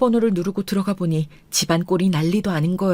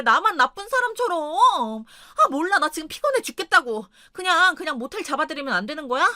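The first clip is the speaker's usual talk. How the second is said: high-pitched, indignant whine; petulant anger, voice pitched up; irritated wife; 7 semitones higher than usual. Acted. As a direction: snap back as an aggrieved wife. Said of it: "아내는 왜 여기서 이러냐며 또 화를 내는".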